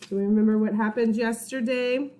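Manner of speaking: ordinary talk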